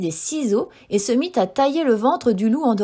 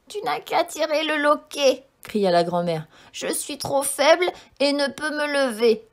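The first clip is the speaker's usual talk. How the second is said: put-on higher, frail old woman voice; weak grandmother; 4 semitones higher than usual. A narrator's reading. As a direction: sound too weak to get up.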